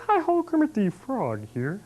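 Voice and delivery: high, drawn-out frog puppet impression; high, slow puppet-frog greeting; Kermit the Frog. Shown as a demonstration. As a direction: do the famous cheery frog puppet. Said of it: "I can do voices that ki-, kids are familiar with".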